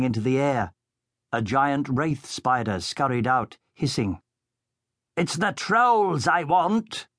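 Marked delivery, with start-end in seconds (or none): 5.2-7.1 slow, higher-pitched villain's shout. A narrator's reading. The Baron shouts his orders at the hunters. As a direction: bellow it out, demanding. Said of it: "the Baron shouted".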